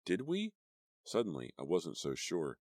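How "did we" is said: fearful doubt. A narrator's reading. Sudden doubt.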